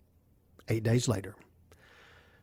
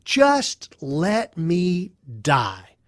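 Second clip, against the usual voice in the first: very slow, drawn-out words; despairing plea; much slower than usual. Acted. Job begging God for death, slow despair. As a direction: weigh down each word with despair.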